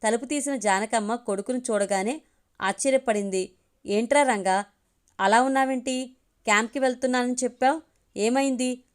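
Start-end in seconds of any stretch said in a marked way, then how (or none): none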